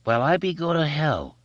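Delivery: high, honking bully voice; high nasal foghorn jeer. A narrator's reading. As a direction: honk it through a bandaged nose. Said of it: "his voice had a nasal foghorning quality".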